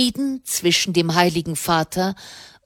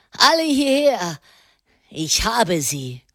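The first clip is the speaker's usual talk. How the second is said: ruffian's shout; 2 semitones higher than usual. A narrator's reading. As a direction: call the whole mob over. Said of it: "schrie er". (hoher, rufender Schrei mit verstellter Stimme)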